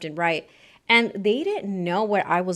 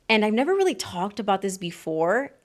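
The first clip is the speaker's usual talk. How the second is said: ordinary delivery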